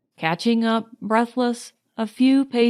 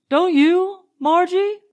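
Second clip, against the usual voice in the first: higher, louder eager question; 9 semitones higher, 4 dB louder than usual. A narrator's reading. Leonard asking Marjorie if she feels it too, eager. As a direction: urge her to agree.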